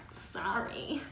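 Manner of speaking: soft, fake little sorry; disingenuous apology. Shown as a demonstration. Showing how not to apologize, a softly insincere sorry. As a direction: mumble an apology you don't mean. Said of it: "don't do a disingenuine sorry".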